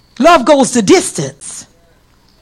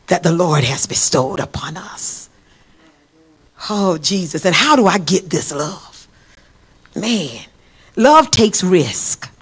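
low, soft, awed reflection; hushed, reverent preaching; 5 semitones lower, 4 dB quieter than usual. Real, not acted. Preacher marvels to the congregation at God's love, hushed and reverent. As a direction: drop low and marvel quietly.

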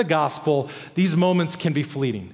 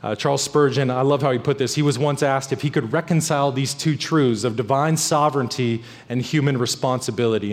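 usual speaking voice

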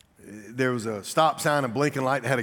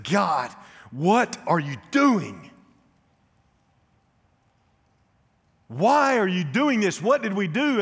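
loud, high, slow pleading shout; anguished cry to God; 8 semitones higher, 6 dB louder, slower than usual. Retold or imitated. Pastor reliving his son's death, crying out to God in anguish. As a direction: cry out to God in grief-stricken anger.

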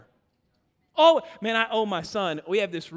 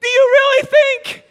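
much higher, louder, shouted disbelief; incredulous high voice; 13 semitones higher, 10 dB louder than usual. Retold or imitated. Dad protesting to his wife, shrill shouted disbelief. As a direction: squeal it in outraged disbelief. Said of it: "I'm like".

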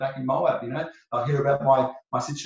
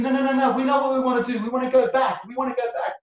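high-pitched, eager insistence; insistent protest; 9 semitones higher, faster than usual. Acted. The people tell Naomi they will go back, insistent. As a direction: pipe up in an earnest rush of refusal.

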